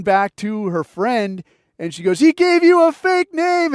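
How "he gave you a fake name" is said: high, loud, drawn-out taunt; mocking roommate. Roommate laughing at her duped friend, mocking taunt. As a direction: crow it slowly at the victim, mock female voice.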